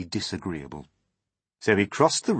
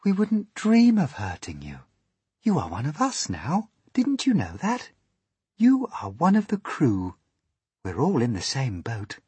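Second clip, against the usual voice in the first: high, swooping ladybird reassurance; kindly ladybird; 7 semitones higher, 5 dB louder than usual. A narrator's reading. Ladybird welcoming frightened James to the crew, kindly. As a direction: reassure in a higher, lilting voice. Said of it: "the ladybird said kindly".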